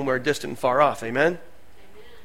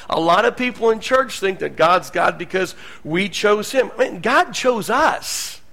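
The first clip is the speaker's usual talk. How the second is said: ordinary delivery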